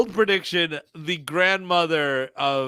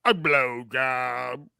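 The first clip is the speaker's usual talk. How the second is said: slow, drawn-out movie quote; movie line imitation; 3 semitones lower, slower than usual. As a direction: lightly imitate the film character's slow delivery. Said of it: "the way he fucking says it".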